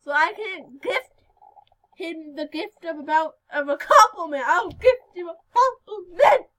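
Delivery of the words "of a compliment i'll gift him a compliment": laughing through words. Real, not acted. Laughing while talking.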